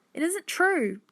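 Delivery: put-on high-pitched girl's voice; high, protesting little voice; reassuring child. A narrator's reading. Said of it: "said Hannah".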